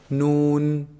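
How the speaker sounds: "Noon" held long, ringing in the nose; nasal noon. Shown as a demonstration. Teacher modelling the ghunna sound, long nasal "Noon". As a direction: hum out the n in "Noon" from the tongue tip. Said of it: "there's a ghunna sound in the noon".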